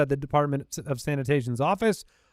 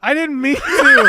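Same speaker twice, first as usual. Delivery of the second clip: laughing; 8 semitones higher, 6 dB louder than usual. Real, not acted. Laughing after accidental swear.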